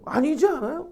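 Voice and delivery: high-pitched acted appeal; seeking agreement. Onlooker seeking others' agreement, high mock appeal.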